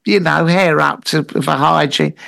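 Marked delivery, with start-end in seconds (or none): none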